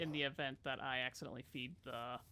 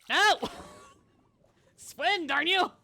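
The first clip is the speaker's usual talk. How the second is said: high, loud shout at the game; frustrated yell; 11 semitones higher, 10 dB louder, much slower than usual. Real, not acted. Player yelling at a drowning character to swim, high frustrated shout. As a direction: yell it in exasperation, pitched way up.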